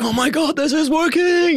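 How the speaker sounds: high, thrilled Oh my God rush; excited disbelief. Retold or imitated. Musician reliving hearing the song take off, thrilled high squeal. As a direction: squeal it like the dream just came true. Realizing their song is working.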